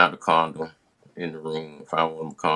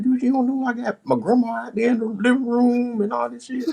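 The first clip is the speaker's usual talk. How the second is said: high pleading voice. Retold or imitated. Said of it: "I'm like".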